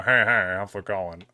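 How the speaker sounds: imitated catchphrase, dropping low on the question; Squidward-like coworker. Coworker at the register greeting him, Squidward-like impression. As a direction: lightly do the cartoonish older coworker. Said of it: "he would just kinda talk like this".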